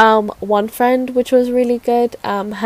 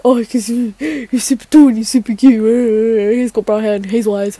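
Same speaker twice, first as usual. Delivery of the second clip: hammed-up lovestruck squealy swoon; swooning crush. Imitating herself telling friends why she likes him, swooning. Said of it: "and I would be like that".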